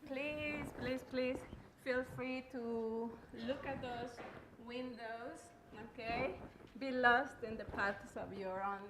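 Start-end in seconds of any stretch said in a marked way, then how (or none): none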